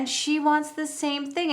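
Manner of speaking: high, eager put-on therapist voice; mock therapist. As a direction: play the chirpy therapist with a big idea. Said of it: "And she's like".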